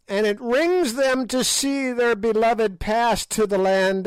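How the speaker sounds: high sneering witch; old witch voice. A narrator's reading. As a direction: play the ancient hag taunting the king. Gagool's rant on the old.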